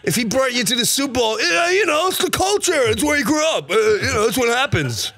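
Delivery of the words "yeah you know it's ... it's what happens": dopey fan voice. Acted. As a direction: shout excuses like a dim fan. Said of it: "Is that your fan voice?".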